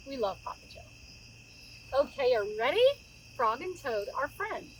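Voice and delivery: normal talking voice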